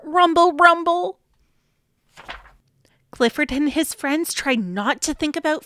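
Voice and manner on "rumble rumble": tummy growl. Acted. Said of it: "His tummy growled".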